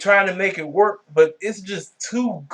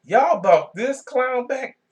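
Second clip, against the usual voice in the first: slow, higher and incredulous, leaning on y'all; stunned disbelief; 9 semitones higher, slower than usual. Retold or imitated. Vlogger repeating his outrage at the wrestler's return, slow and incredulous. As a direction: drag it out like you truly can't believe it. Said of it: "I said".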